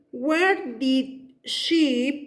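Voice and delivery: each word drawn out very slowly, What time did she; slow dictation. Shown as a demonstration. Teacher dictating to students while writing, very slow. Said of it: "después del where viene".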